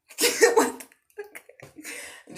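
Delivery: high squeak breaking into a breathless laugh; laughing disbelief. Real, not acted. Gamer ranting at the game, laughing in disbelief.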